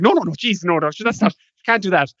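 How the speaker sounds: mock Irish mum objection. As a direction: imitate a flustered mum refusing an offer. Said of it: "you know how Irish mums are".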